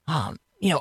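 ordinary delivery

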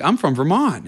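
thrilled to find a neighbor; excited reply. Retold or imitated. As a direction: burst out with the coincidence. Excited reply, recognizing shared roots.

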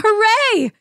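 high mock cheer; crowd yelling. A narrator's reading. Crowd cheering the man, high joyful mock yell. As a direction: call it out like a crowd. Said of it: "and crying".

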